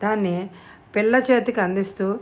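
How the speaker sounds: usual speaking voice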